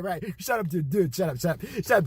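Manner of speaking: usual speaking voice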